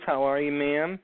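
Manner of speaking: ordinary talk, nothing marked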